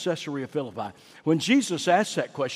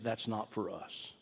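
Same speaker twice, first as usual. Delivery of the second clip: ordinary talk, nothing marked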